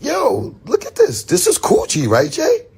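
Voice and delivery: high, squeaky excited voice; excited squeal. Retold or imitated. On the phone to Jay, excitedly squealing at the Coogee. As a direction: squeal it out, thrilled at the find. Showing Jay the jacket, pitch soaring.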